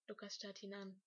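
ordinary delivery

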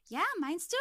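high, loud eager question; eager boy. A narrator's reading. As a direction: pester hopefully in a boy's voice. Boy eagerly prodding the narrator.

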